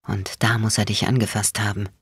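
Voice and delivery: low, restrained growl; quiet growl. A narrator's reading. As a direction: keep it deep, quietly menacing. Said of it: "Bones Stimme war ein leises Knurren".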